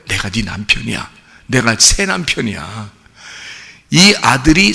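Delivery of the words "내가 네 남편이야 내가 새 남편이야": a hint of Jesus lovingly claiming her; lower, softer Jesus. Preacher voices Jesus to widow.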